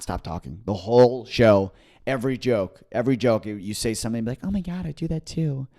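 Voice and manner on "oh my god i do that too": put-on high-pitched gushing girlfriend voice; mimicked chatty audience women. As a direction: imitate a gushing audience member chatting to her friend. Mimics women chattering through show.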